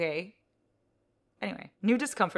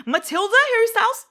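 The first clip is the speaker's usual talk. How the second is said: excited; 14 dB louder than usual. Real, not acted. Delighted at the next songs.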